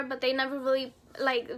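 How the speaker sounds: ordinary talk, nothing marked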